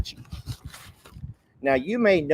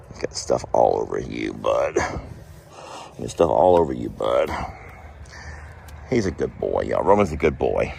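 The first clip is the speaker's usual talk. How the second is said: much lower, gentle praise; doting on the dog; 12 semitones lower than usual. Real, not acted. Praising Roman the dog to viewers, low doting voice. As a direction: reassure the dog he's good.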